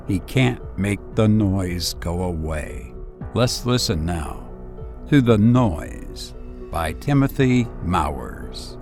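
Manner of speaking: ordinary delivery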